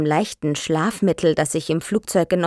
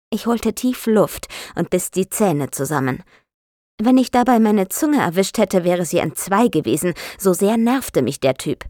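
ordinary delivery, nothing marked

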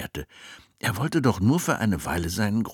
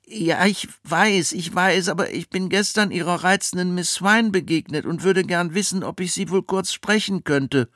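put-on boy voice; 12 semitones higher, 4 dB louder than usual. Jack speaks.

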